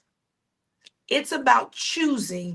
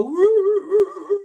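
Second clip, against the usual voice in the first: high howling dog whimper; yelping woo of a dog on a nail; 8 semitones higher, 5 dB louder than usual. Retold or imitated. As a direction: howl high like a hurt dog. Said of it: "And the dog would go".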